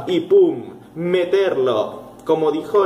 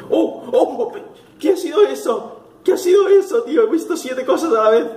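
high-pitched shocked exclamations; startled alarm; 9 semitones higher than usual. Real, not acted. Player seeing things appear at once, high startled alarm. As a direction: cry out as if something just flashed past.

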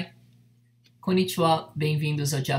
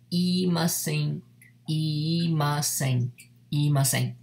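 imasen drawn out syllable by syllable; teacher slowly spells out imasen for learners; slow model pronunciation; slower than usual. Shown as a demonstration. Teacher models imasen slowly to students. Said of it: "Já na forma formal".

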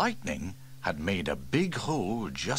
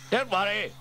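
Steele voiced much higher, firm; reassuring chief; 12 semitones higher, 4 dB louder than usual. Fire chief reassures the caller on the phone, firmly.

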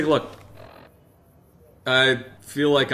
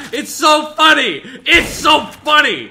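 high, loud, slow, laughing shout; 12 semitones higher, 7 dB louder, slower than usual. Real, not acted. Laughing loudly at joke.

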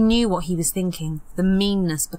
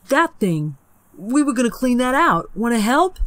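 higher, louder eager lure; feigned eagerness; 5 semitones higher, 4 dB louder than usual. Acted. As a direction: sell the chore brightly. Peter luring her.

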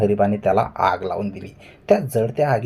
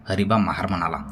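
plain reading voice

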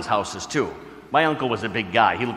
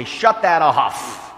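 slow, stern command to shut it off; mock angry uncle; angry order; 4 semitones higher, 6 dB louder, much slower than usual. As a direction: snap it like a hot-tempered uncle. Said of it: "a tremendous temper".

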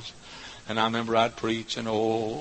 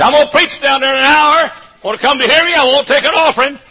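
loud, much higher hollering invitation; calling out; 14 semitones higher, 11 dB louder than usual. Retold or imitated. Young preacher hollering to folks on country roads, calling out. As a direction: holler it from the car window.

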